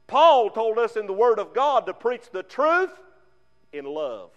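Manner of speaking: ordinary delivery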